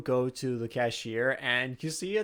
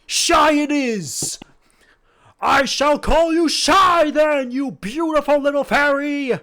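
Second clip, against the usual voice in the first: dopey sweet himbo; 11 dB louder than usual. Acted. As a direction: shout it like a delighted big lug. Bang booms happily.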